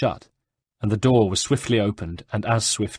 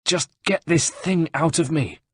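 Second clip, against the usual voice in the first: high, pained snarl; 8 semitones higher than usual. A narrator's reading. Wounded Rosa snarls at Antonio, high and pained. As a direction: snap it out through the pain. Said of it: "snarled Rosa".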